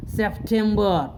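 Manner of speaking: सितंबर said slowly as septhembe, aspirated t and no final r; slow demo of सितंबर, final r left off; english pronunciation. Shown as a demonstration. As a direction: say सितंबर with a breathy th and drop the er. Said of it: "ट को ठो पढ़ेंगे और अंत वाला जो ई आर होता है उसको नहीं पढ़ना".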